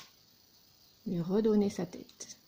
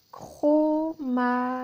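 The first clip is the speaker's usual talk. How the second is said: invented "dromadaire" loud, high and slow as cro-ma-daire; "dromadaire" begun with cro, every syllable held loud; 7 semitones higher, 7 dB louder, much slower than usual. Shown as a demonstration. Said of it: "nous obtenons ici".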